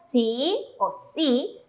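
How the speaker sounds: si o si said slowly, in a set-apart voice, as the wrong way; mock wrong pronunciation si; wrong reading si. Shown as a demonstration. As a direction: drag out si o si as the sound to avoid. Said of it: "no se pronuncia".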